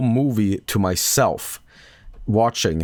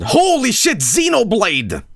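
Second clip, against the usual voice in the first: excited shout; 10 semitones higher, 4 dB louder, slower than usual. Retold or imitated. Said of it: "someone said".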